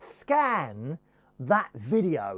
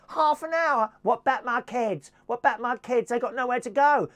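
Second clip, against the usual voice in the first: put-on loud, high working-class British accent; put-on tenant accent; 6 semitones higher, 4 dB louder than usual. Evicted tenant pleading with the officer, loud working-class accent. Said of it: "got to do the accent".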